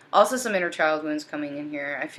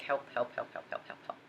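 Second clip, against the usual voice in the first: repeated cries for help that shrink to a whisper; plead, then let it die away into breath; pleading cry fading out; 3 semitones lower, 12 dB quieter than usual. Retold or imitated. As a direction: voice of the divine masculine begging, fading to a whisper. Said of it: "It's like they're just like".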